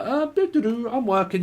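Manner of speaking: high sing-out busy-worker voice; hammed-up busy worker; oblivious worker. Acts someone busily working.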